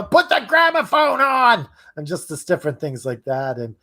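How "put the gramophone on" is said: high, drawn-out mock yell; imitated yell. Co-host imitates Jeff yelling, high mock yell. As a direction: call it out high and slow, in character. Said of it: "sometimes he would yell".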